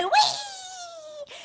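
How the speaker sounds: high gleeful whee of a flying bird; joyful screech. Acted. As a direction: squeal with joy in flight. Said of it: "screeching with joy".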